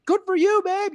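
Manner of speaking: high, loud mock cheer; excited spouse on FaceTime. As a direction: cheer on your partner in an imitated high voice. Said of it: "because of how excited they were".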